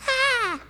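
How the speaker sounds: sudden very high, loud yelp of pain; pained scream. Acted. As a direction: shriek as if a finger got caught. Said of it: "he was like".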